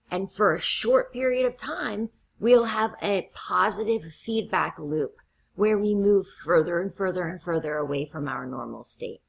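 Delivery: usual speaking voice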